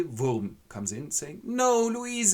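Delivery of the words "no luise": dramatic cry. Acted. Villain sees her dead.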